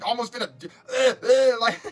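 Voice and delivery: a choked half-laugh, half-cry; laughing through emotion. Real, not acted. Recalling almost starving, choked emotional laugh. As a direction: laugh as it catches in the throat.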